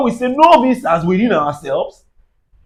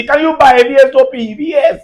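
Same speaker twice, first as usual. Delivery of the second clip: ordinary talk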